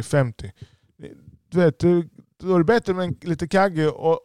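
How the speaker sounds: ordinary talk, nothing marked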